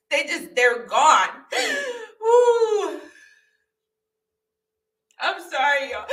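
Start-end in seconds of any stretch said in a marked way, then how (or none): 1.5-6.1 real tearful gasp, then a high, teary woo and a sob-laugh apology; crying on camera. Real, not acted. Apologizing to viewers for crying on camera, tearful gasp. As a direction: overcome by tears mid-story, apologizing. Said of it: "I'm sorry that I'm crying on camera".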